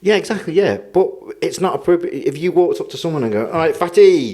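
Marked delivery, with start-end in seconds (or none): 3.5-4.3 high, loud taunting call; mock jeering greeting. Someone walking up to a stranger, mock jeering. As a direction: call it out cheekily, half laughing.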